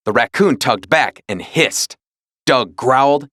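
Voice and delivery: ordinary delivery, nothing marked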